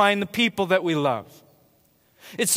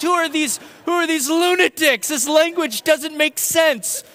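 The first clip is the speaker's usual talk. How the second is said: confused visitor; 8 semitones higher than usual. Retold or imitated. Voicing confused visitors' thoughts.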